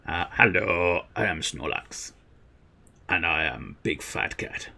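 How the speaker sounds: low, soft sleepy creature voice; Snorlax voice. Acted. Streamer voicing a sleepy Snorlax greeting, low and soft. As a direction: sink low and mumble like a sleepy giant cat. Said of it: "you've been welcomed by Snorlax".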